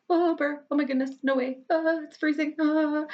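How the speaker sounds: high-pitched freezing yelps; squealing at the cold. Acted. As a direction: gasp and squeal as cold water hits. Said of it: "when Sasha gets in the ocean, I'm like".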